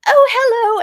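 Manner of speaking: a gushing Australian hello, imitated badly; Australian accent. Retelling meeting Margot Robbie on set, badly faked high Australian accent. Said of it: "I can't do an Australian accent".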